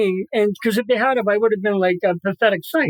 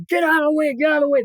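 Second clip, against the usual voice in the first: raised, higher urgent yell to clear the hall; urgent shout; 6 semitones higher, faster than usual. Retold or imitated. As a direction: shout people aside while running a stretcher. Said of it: "Now he starts screaming".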